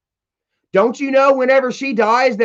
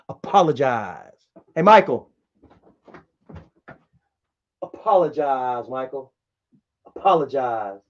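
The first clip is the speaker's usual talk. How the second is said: taunting demand; 8 semitones lower, 3 dB quieter than usual. Acted. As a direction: drag out apologize low and menacing. Taunting brother to apologize.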